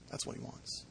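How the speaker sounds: breathy whispered aside. Real, not acted. Preacher whispering the point to the congregation, a whisper. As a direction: drop to a whisper. Whispered tag after prayer.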